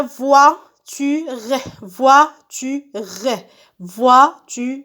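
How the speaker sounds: voiture slowly split as VOI, TU, RE; syllable by syllable. Shown as a demonstration. Teacher on the last picture, syllable by syllable. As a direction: sound out voiture with a gap after each syllable. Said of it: "Je frappe les syllabes".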